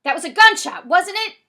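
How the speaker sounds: high, loud pressing question; loud demand. A narrator's reading. Man on the phone questions the boy, loud and urgent. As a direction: shout it as an alarmed challenge.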